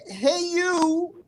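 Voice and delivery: high, drawn-out catchphrase greeting; catchphrase call. Retold or imitated. Host reads out a super sticker, high catchphrase call. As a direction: sing out the nickname like a catchphrase.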